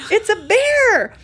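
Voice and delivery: high, loud and slowed, stretching "bear"; drawn-out excited shout. Retold or imitated. Wife repeating the bear sighting to husband, slow loud shout. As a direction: let "It's a bear!" ring out slow and loud.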